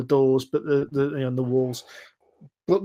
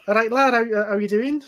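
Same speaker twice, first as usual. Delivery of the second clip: higher-pitched attempt at a Scouse accent; Lister's Scouse voice; 7 semitones higher than usual. Game master voices the ginger stranger, shaky Scouse attempt. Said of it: "gonna go for a Lister voice".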